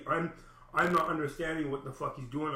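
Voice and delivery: ordinary talk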